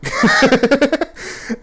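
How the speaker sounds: high, loud laugh breaking out; cracking up at the memory; real burst of laughter. Real, not acted. Laughing at the drunk old ladies, high loud laugh.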